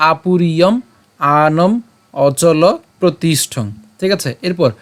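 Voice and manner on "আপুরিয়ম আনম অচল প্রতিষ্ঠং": slow, careful recital of the line; slow recitation. Shown as a demonstration. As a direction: recite each word slowly. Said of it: "আমি আবার বলি". (ধীরে ধীরে শ্লোক আবৃত্তি)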